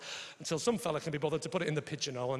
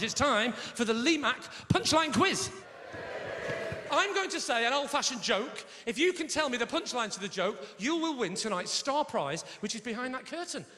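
slow, grand host announcing; game show host; 6 semitones higher, slower than usual. Acted. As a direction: announce like a cheesy TV quiz host.

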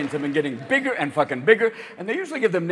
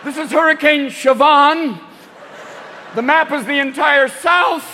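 panicky weatherman; 8 semitones higher than usual. Retold or imitated. Weatherman presenting the hurricane map to viewers, panicking. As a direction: play a freaked-out TV weatherman. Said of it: "He's going".

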